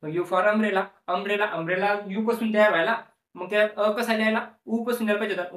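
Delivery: usual speaking voice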